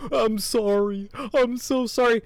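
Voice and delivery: high, mock-whimpering apology; whiny apology. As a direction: imitate a criminal grovelling. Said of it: "they're like".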